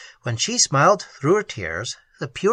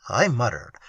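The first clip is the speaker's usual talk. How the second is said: ordinary delivery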